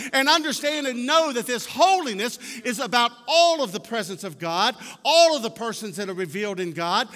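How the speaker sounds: loud, high, ringing pulpit proclamation; full-force preaching. Real, not acted. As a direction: drive each phrase out with mounting conviction.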